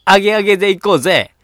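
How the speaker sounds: loud party-hype voice; gyaru speech. Acted. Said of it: "ヒップホッパーを呼びしましょう". (大声でノリノリのパーティー口調)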